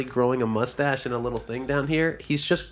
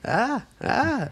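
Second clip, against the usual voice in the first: mock customer grunts; 12 semitones higher than usual. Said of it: "He's like".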